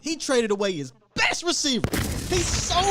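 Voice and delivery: crank it up, outraged on his behalf; worked-up rant. Real, not acted. Host riling up caller over the owner, heated and high.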